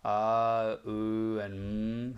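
A, U and M each held evenly; equal-length sounds. Shown as a demonstration. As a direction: draw out A as "ah", U as "oo", M as a hum. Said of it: "all three sounds have to be of the equal proportions".

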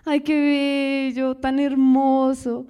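slow, lovestruck sighing over a sweet story; mock swoon of a romantic moviegoer. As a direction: melt like someone watching a love story. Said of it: "Como que".

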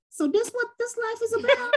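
high, astonished exclamation; as if the world just opened up; amazed disbelief. Retold or imitated. Seeing the Caribbean for the first time, high and amazed. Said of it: "I was like".

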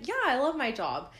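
high, loud, upbeat retelling; eager reply. Retold or imitated. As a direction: act out a keen answer. Acting out her eager reply.

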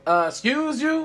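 slow, high indignant excuse you; sassy offense. Acted. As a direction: act scandalized, drag it out.

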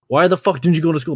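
dad chewing out his son, mock anger; dad bitching out. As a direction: channel the furious father. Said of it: "bitching out at me".

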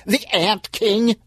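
high, slow, incredulous; can't believe his ears, slowly; mock outraged yell; disbelieving yell. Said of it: "yelled Stan".

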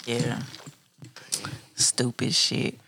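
ordinary talk

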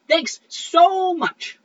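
slow, loud, icy sarcastic thanks. Acted. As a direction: drag out the thanks with mock gratitude.

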